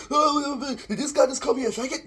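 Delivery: high, loud mocking whine; mock-offended high voice; mocking Lisa. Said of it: "watch Lisa saying".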